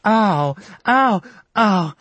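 high, loud yelps; repeated pained howls; cries of pain. Acted. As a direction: wail each ow from the next room. Caroline crying out in pain.

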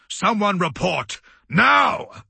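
high bellow, building to a shout. A narrator's reading. Commander demanding a report from his deck crew, bellowing. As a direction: bellow the order, hardest on the last word. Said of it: "Amatnim bellowed".

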